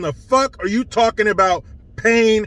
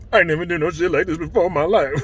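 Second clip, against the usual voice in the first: laughing quote; 5 semitones lower, much faster than usual. Retold or imitated. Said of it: "he said".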